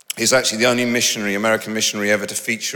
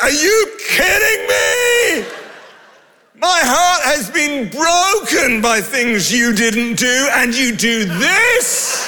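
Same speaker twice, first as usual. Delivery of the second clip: furious outburst at God; 13 semitones higher, slower than usual. Retold or imitated. Preacher reenacting shouting at God on the yacht, mock outrage. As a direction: yell it up at the sky in disbelief. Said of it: "I was so cross".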